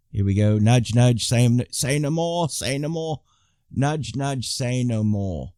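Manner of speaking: put-on British Monty Python patter; British accent. Host showing off a better accent with a Python quote, British accent. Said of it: "I do a better British accent".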